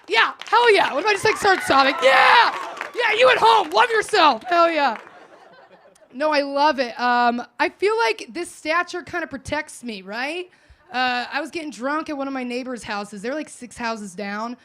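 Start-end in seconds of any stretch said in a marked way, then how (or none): none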